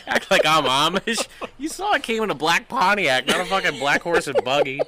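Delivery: loud, high, laughing indignation; laughing while pretending to be offended; laughing mock rant.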